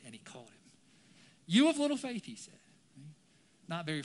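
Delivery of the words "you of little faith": high, loud, falling reproach; loud rebuke. Acted. Jesus chides Peter as he catches him, loud rebuke.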